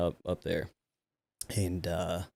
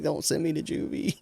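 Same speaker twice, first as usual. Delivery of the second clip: high whiny mock plea; mock pleading; 9 semitones higher than usual. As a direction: beg like a scared kid, overdone. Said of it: "he's like".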